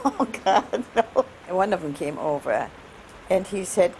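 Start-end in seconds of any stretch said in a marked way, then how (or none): 0.0-1.3 higher, half-laughing mock dismay; retold dismay. Telling of soldiers waving to her, half-laughing mock dismay. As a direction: retell the dread with a laugh.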